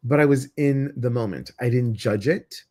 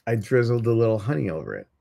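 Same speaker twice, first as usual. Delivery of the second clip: normal talking voice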